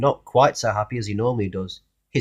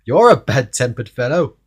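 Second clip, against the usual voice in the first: cheery scolding; 7 dB louder than usual. Acted. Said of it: "laughed Mister Happy".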